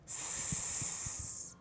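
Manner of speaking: hissed s sound alone. Shown as a demonstration. Teacher demonstrating the letter sound, a long hissed s. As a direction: hiss a long s for the kids. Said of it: "نقول من الأول".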